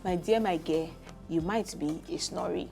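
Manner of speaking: usual speaking voice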